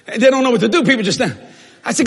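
ordinary talk

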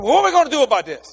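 high, loud and fast; retold fast question. Other man asks what to do, high and fast. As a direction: fire it off quickly and loudly.

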